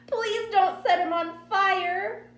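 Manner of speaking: high, loud, drawn-out wail; hysterical child's plea. A narrator's reading. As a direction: wail it in desperate terror. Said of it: "went crazy".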